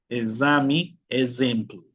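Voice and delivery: "Exame, ejemplo" as "ezami, ezemplu"; bee sound Z. Shown as a demonstration. Teacher repeating examples, "Exame, ejemplo" with buzzed Z. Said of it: "el sonido de la abeja".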